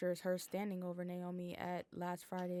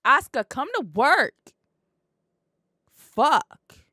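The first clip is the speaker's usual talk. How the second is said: loud, higher call-out; shouting; 7 semitones higher, 20 dB louder, slower than usual. Real, not acted. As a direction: yell it out like a rallying cry. Calling out to absent wrestler.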